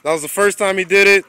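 excited cheer. Real, not acted. Cheering the jump.

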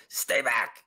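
restrained mock scream. Cornered griffin warning off would-be helpers, mock screaming. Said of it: "just starts screaming back".

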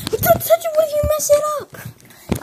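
high, loud panicked protest; panicked shout. Acted. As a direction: shriek to keep hands off the toy. Panics as toy is touched.

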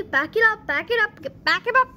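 high, loud, quick-fire repeats; urgent urging. Real, not acted. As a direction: urge it on with rising insistence. Urging engine to reverse.

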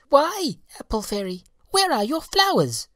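very high, bright chirping question; chirping birds. Acted. Birds asking the apple fairy, very high and chirping. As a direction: chirp like a little bird. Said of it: "they chirped".

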